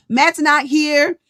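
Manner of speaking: ordinary delivery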